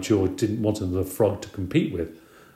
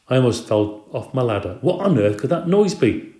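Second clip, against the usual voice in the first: usual speaking voice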